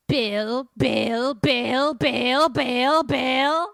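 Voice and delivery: very loud chanting demon, rising steadily; hammed-up possessed roar; demonic possession voice. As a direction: bellow each word, climbing in pitch. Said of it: "it has this voice".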